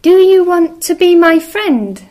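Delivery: high, loud eager little mouse. Acted. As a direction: call out brightly like a tiny mouse. Mouse asks each animal.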